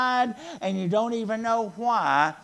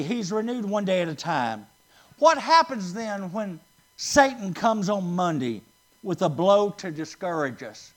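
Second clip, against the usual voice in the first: ordinary delivery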